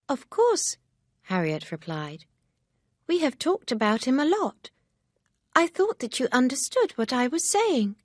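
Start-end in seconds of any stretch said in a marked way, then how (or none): 0.1-0.8 of course, as if obvious; Harriet, amused, much higher; bright surprised Harriet. A narrator's reading. 3.1-8.0 higher, lightly voiced young girl; a hint of an eager young friend; Harriet, lightly voiced, eager. A narrator's reading.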